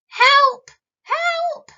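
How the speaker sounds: high frantic cry for help; a trapped carrot yelling high and loud for help; panicked carrot cry. A narrator's reading. Stuck carrot shrieking for help, high and loud. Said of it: "cried Carrot".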